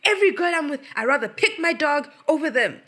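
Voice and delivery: high, loud mock scream of a furious partner; imitated scream. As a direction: shriek it like Tiff losing it. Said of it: "Tiff started fucking screaming".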